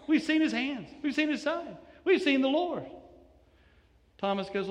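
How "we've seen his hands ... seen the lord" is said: higher, pleading repetition; eager insistence. Acted. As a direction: press each phrase on him. Disciples pressing Thomas.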